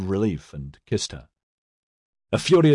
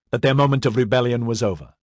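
ordinary delivery, nothing marked

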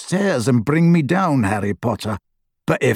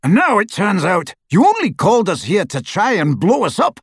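raised, high-pitched furious accusation; angry roar; 7 semitones higher than usual. A narrator's reading. Death Eater accusing Lovegood of a trap, roaring. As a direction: roar it without much added volume. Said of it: "roared the Death Eater".

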